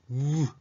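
"う" with lips rounded as tightly as possible; tightly rounded vowel. Shown as a demonstration. Teacher showing the first English u, "う" tightly rounded. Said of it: "唇を思いっきり丸めて".

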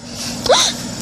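a quick unvoiced high gasp; breathy high gasp. Spotting her lost pony lying down, gasping.

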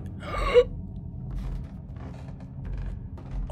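high breathy startled gasp; jolted gasp at a scare; sharp scared gasp. Real, not acted. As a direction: gasp in sudden fright. Sudden scare in game.

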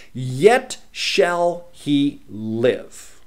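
slow, loud, drawn-out solemn reading; a preacher intoning Jesus' words, slow and loud; scripture reading. Acted. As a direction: proclaim each phrase slowly and heavily.

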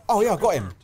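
usual speaking voice

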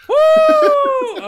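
loud, high victory whoop; excited whoop. Real, not acted. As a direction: let out a big triumphant cheer. Celebrating a win.

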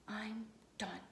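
a tired, whispered giving up; defeated whisper. Acted. Boy quitting drawing, whispered in defeat. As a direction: breathe it out, beaten and done.

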